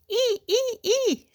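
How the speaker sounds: three short high squeals; children echoing a scream. A narrator's reading. Pupils repeat teacher's cry without understanding, high squeals. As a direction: mimic the shriek in quick, high bursts. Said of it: "répètent les enfants".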